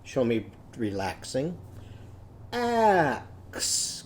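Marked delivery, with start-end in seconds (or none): 2.5-4.0 "Axe" stretched into its sounds, higher; sounded out. Shown as a demonstration. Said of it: "Can you guess my word".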